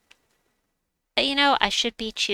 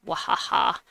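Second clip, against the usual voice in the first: cartoon laugh. Villain laugh after match.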